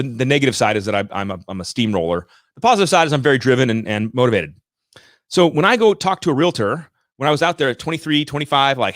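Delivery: usual speaking voice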